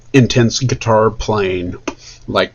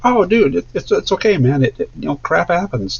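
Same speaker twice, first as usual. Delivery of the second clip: reassuring; 7 semitones higher than usual. Retold or imitated. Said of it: "And I was like".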